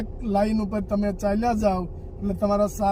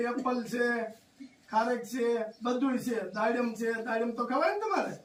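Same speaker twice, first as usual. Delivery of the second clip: gentle consoling; 2 semitones higher, 7 dB quieter than usual. Real, not acted. Visitor comforts a crying elderly woman, softly coaxing. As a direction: soothe her like calming a grandmother.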